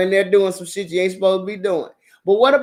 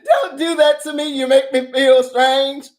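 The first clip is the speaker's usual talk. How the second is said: mock flustered man; 6 semitones higher, 4 dB louder than usual. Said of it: "mock man, slow".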